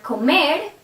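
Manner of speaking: comer with the weight on "mer", lifting at the end; comer, demonstrating stress on the "er" ending; stress on final syllable. Shown as a demonstration. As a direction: lean on the last syllable of comer. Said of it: "stress er".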